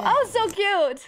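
high drawn-out delighted cry; delighted squeal. Real, not acted. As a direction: gush over something adorable. Delighted at cover.

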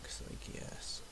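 almost inaudible, breath-only whisper. Real, not acted. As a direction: drop right down into a whisper. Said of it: "I'm gonna whisper".

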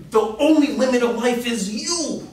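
high, loud, hammed-up hype; hyped motivational quote. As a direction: pump up the crowd. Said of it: "I was in there intense, passionate".

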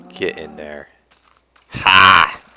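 ordinary talk

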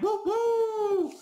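high, loud sustained woo woo; whoops. Real, not acted. Celebrating his comeback for viewers, two high loud whoops. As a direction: cheer out a long double whoop.

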